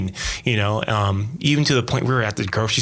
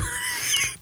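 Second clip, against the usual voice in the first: sudden high giggle; high squeaky laugh; 8 dB quieter than usual. Real, not acted. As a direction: burst into an embarrassed laugh. Laugh after confession.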